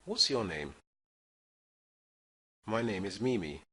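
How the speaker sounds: usual speaking voice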